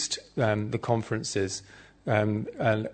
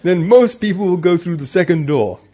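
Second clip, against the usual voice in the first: higher, louder retold Austrian accent; 6 semitones higher, 10 dB louder than usual. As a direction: put on a hearty Austrian accent for the joke. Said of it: "in his Austrian accent".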